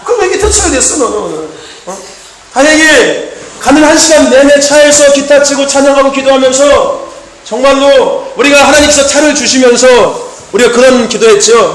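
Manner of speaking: usual speaking voice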